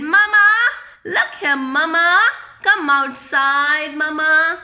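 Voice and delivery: put-on high, loud child calling Mama. Boy calls his mum to come outside, loud and high. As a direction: call Mama to come and look.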